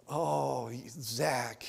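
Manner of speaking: ordinary talk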